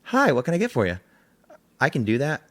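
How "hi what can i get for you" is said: bright, high shop-counter greeting; chirpy cashier welcome; customer service voice. Shown as a demonstration. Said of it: "I can customer service voice".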